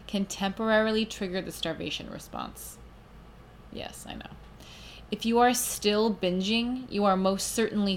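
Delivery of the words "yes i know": 1 whispered aside. Real, not acted. Aside to listeners' objection.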